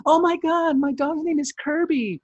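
very high, loud squealing imitation; excited neighbor. Neighbor meeting Furby, imitated high excited squeal. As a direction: mimic her gushing way up high. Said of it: "she talks to him in dog voice".

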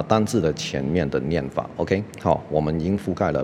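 usual speaking voice

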